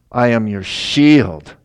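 slow, loud proclamation; slow, loud divine promise; booming voice of God. Acted. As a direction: declare it like God speaking. God's voice quoted in sermon.

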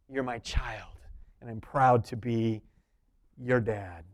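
put-on a father reassuring his child of his pride; tender father. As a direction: gently tell your child you're proud of them. Jesus voiced tenderly to believer.

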